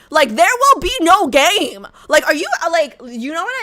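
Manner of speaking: heated rant. Real, not acted. Heated rant against trolls.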